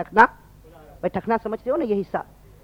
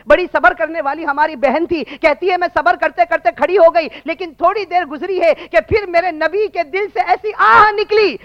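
sermon voice climbing higher line by line; impassioned preaching; 7 semitones higher, 6 dB louder than usual. Acted. Preacher telling the congregation of the Prophet's sigh, fervent. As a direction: build to an impassioned high peak.

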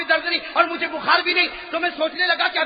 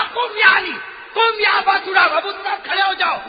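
commanding call; 6 semitones higher, 5 dB louder than usual. Acted. Prophet commands Ali to rise.

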